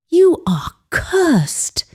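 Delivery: slow, high, trembling warning; an old herbalist shaken by what he sees; fearful elder's voice. A narrator's reading.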